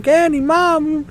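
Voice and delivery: put-on high, slow drawn-out childish whine; whining child. Child whining to mom for candy, high and slow. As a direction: stretch it out like a sulking kid. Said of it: "and starts whining".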